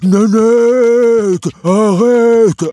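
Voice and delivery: high, loud desperate calls; shouting for his friend. Acted. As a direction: cry out her name in panic. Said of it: "Gougou hurlait".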